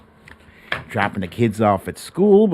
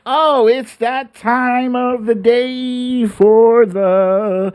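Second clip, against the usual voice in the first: slow, louder, higher segment announcement; announcer intro; 9 semitones higher, 5 dB louder, much slower than usual. Acted. Host to listeners, introducing the segment, drawn-out announcer call. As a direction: draw it out like a show bumper.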